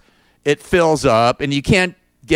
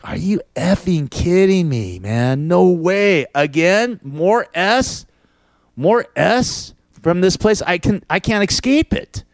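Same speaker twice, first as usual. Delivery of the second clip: higher, building outrage at yet another problem; exasperated disbelief; 6 semitones higher, slower than usual. Retold or imitated. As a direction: throw up your hands, fed up. Exasperated retelling of sink flooding.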